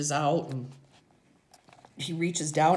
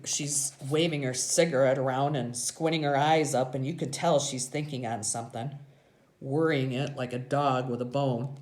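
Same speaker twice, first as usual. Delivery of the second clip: plain reading voice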